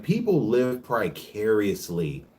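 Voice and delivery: ordinary talk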